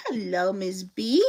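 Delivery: ordinary talk, nothing marked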